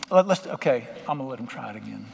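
normal talking voice

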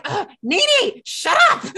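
high, loud parrot impression. Parrot telling its sibling Nene to shut up, high and loud. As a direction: play an irritated parrot yelling at its sibling. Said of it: "the bird is just like".

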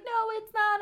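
high mock whimper; imitating upset child. Crying son rejecting parent's comfort, upset whine. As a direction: protest in a high kid's whine. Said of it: "he was really upset".

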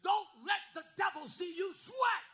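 loud, driving pulpit shout; full-force preaching. Acted. Pastor exhorting his church to stand firm, preaching at full force. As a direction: rouse the congregation, lifting pitch and volume toward the close.

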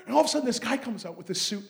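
ordinary talk, nothing marked

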